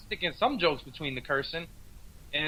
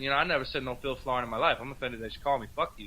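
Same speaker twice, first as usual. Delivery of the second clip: low mock retort; 6 semitones lower, 7 dB quieter, much faster than usual. Comic's own retort, low.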